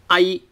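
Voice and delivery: short a into short i, like in ice; Cantonese final ai. Shown as a demonstration. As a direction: say I with a very short a then a short i. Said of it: "It's like in ice".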